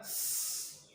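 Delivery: "स" as a long pure s with no vowel; isolated first sound. Shown as a demonstration. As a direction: hold "स" as a sustained sss. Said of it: "हमारा पहला sound आ रहा है".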